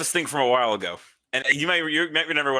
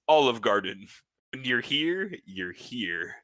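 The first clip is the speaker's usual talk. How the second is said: usual speaking voice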